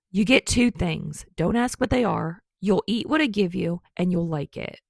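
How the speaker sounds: loud, bossy and gruff; loud gruff order. A narrator's reading. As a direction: bark it out like a grumpy baker. Bakugo bossily orders Kirishima.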